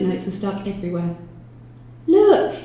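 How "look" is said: high, loud excited call; yell. A narrator's reading. Detective alerting his partner to a find, high yell. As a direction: yell it out sharply. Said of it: "yelled Jones".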